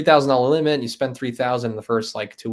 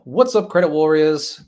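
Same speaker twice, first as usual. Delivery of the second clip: higher-pitched imitation of a presenter's show intro; Ben Hedges; 6 semitones higher than usual. Streamer imitating Ben Hedges opening his show, presenter impression. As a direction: put on another host's accent and lilt. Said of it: "How's your Ben Hedges?".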